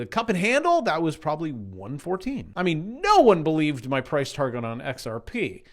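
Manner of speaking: ordinary talk, nothing marked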